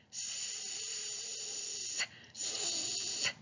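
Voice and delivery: long unvoiced hiss, teeth together; hissed S sound. Shown as a demonstration. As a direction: push air through closed teeth, sss. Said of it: "this next letter is the letter S".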